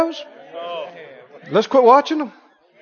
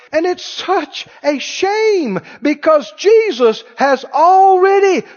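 higher and louder, full-force preaching; preaching shout; 9 semitones higher, 4 dB louder than usual. Real, not acted. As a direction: build up to a ringing proclamation. Preacher's climax on grace.

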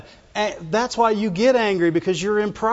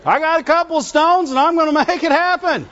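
high, puffed-up bragging king; boastful Uzziah; 7 semitones higher than usual. Retold or imitated. Preacher voicing King Uzziah defying the priests, boastful. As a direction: brag like a spoiled king who can't be told no. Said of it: "I guess he didn't have matches".

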